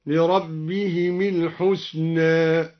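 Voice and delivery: "لربهم الحسنى" linked with "-himi"; meem with kasra. Shown as a demonstration. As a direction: recite "لربهم" slowly, ending in an i before "الحسنى". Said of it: "وكسر الميم من قوله تعالى". (كسر ميم "لربهم" عند وصلها بـ"الحسنى")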